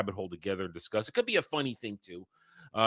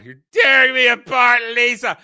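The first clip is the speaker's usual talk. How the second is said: much higher, loud anguished cry; Tommy Wiseau impression; 10 semitones higher, 11 dB louder than usual. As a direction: scream it like a torn-up melodramatic lover. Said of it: "I'm not even gonna try to do that dude's accent".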